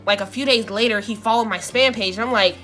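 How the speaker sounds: usual speaking voice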